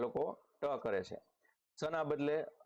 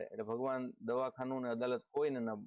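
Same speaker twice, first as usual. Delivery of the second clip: usual speaking voice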